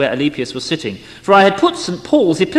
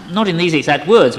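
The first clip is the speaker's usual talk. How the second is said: ordinary delivery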